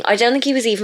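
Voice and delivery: ordinary delivery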